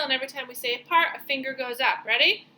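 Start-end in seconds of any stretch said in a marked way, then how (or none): none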